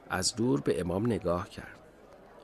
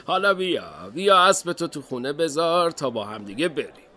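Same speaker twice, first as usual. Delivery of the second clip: loud, higher-pitched cheerful dad; smiling father; 7 semitones higher, 5 dB louder than usual. Acted. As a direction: coax your child along with a smile. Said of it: "پدر لبخندی زد و گفت". (پدر خندان با صدای بلند)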